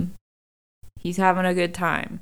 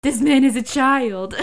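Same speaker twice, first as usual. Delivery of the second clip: louder, higher, laughing echo; emphatic echo; 5 semitones higher, 7 dB louder than usual. Retold or imitated. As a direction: echo it with glee.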